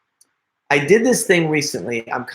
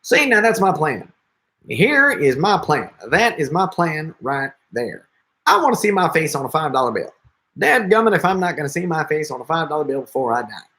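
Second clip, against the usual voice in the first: higher-pitched folksy politician impression, speeding up; Ross Perot; 3 semitones higher than usual. Shown as a demonstration. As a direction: imitate an insistent politician pitching his plan. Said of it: "he would kinda go".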